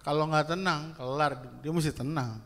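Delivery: normal talking voice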